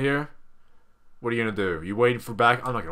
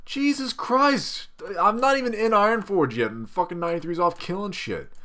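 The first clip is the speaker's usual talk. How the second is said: exasperated; 8 semitones higher than usual. Real, not acted. Exasperated at his rampaging pet.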